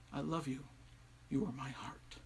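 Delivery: soft, low declaration of love; hushed, loving confession; tender wife. A narrator's reading. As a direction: murmur it tenderly, close by.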